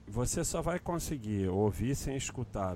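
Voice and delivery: ordinary delivery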